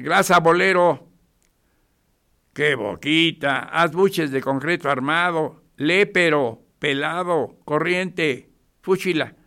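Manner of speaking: retold scolding insults. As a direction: hurl old-fashioned insults with disgust. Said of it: "Antes decían".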